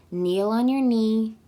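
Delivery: usual speaking voice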